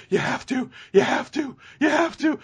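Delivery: high, panting mock plea; imitated panting. Imitating a father's breakdown on the phone, mock panting plea. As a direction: repeat it as a winded, desperate plea. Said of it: "and then the panting and the".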